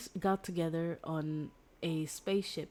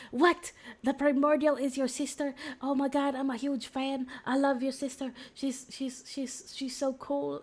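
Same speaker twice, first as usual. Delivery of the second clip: squealing higher and louder, starstruck; excited fangirl; 8 semitones higher, 3 dB louder than usual. Retold or imitated. Girl discovers his sister is her hero, gushing fangirl. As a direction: gush like a fan meeting her idol.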